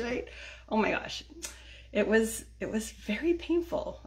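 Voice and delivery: ordinary talk, nothing marked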